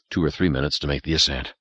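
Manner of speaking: ordinary delivery, nothing marked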